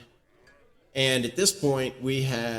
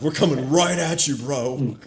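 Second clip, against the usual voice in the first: mock defiance; 3 semitones higher, 5 dB louder than usual. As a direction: throw the challenge right back, loudly. Players defy a villain's threat.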